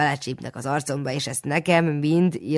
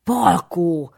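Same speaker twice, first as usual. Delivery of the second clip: high, louder scolding call; sharp rebuke; 4 semitones higher, 6 dB louder than usual. A narrator's reading. Aunt jerks the boy's arm, scolding loudly. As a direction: snap his name as a warning.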